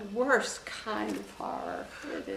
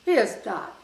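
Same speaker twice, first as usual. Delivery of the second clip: sharp, loud retold denial; mock angry denial; 3 semitones higher, 11 dB louder than usual. General furiously denying the torture, retold loudly. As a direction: snap it back loud in his defiance. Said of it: "He says".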